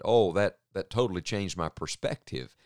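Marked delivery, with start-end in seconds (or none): none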